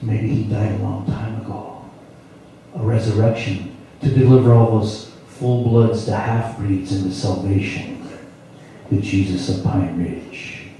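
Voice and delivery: ordinary talk, nothing marked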